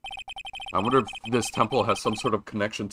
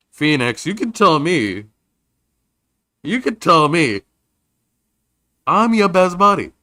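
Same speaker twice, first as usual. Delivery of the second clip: eager teasing friend; 6 semitones higher, 6 dB louder than usual. Acted. Maya teasing Phoenix.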